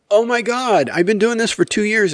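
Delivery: high-pitched retold complaint; acted-out aggrieved husband; mock exasperation. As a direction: protest in disbelief, pitch way up. Retold exasperated reply to wife.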